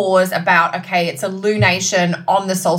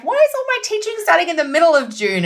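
exasperated; 10 semitones higher, faster than usual. Retold or imitated. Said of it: "And I'm like".

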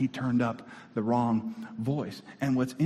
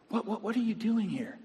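soft, higher-pitched stammered question; God sounding baffled; 8 semitones higher, 5 dB quieter than usual. Acted. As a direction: stammer it in quiet disbelief. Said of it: "I see God coming to Elijah".

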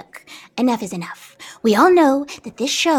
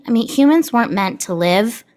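ordinary delivery

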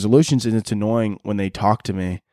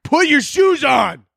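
high, slow, loud mock disgust; disgusted friend; 4 dB louder, slower than usual. Friend seeing his toe in water shoes, loud mock disgust. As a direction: shriek like a grossed-out friend. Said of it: "They're like".

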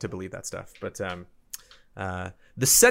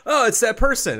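mock recognition; 12 semitones higher, 5 dB louder than usual. Mimicking own recognition moment.

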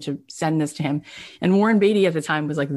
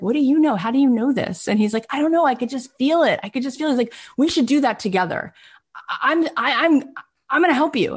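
excited; 8 semitones higher, faster than usual. Retold or imitated. Brian promising to help Ron, high and excited. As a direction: burst with conviction. Enthusiastic back-and-forth.

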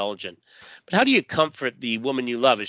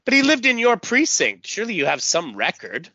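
insistent; 9 semitones higher, 4 dB louder than usual. Acted. Strata presses the officer.

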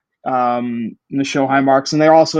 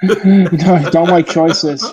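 mock grumble; 4 semitones higher, 5 dB louder, much slower than usual. Mocking their own indecision.

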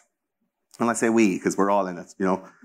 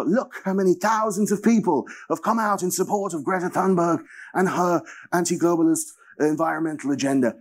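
high, put-on media voice; mock news anchor; 7 semitones higher than usual. Mocking mainstream media coverage, in a high smug announcer voice. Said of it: "it was just like".

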